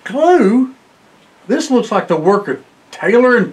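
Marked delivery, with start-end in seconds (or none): 0.0-0.8 incredulous question. Real, not acted. Incredulous echo of glue.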